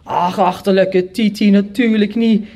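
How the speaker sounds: mild scornful shout; other brother shouting. A narrator's reading. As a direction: shout down your silly brother. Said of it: "riep mijn andere broer". (smalend roepend)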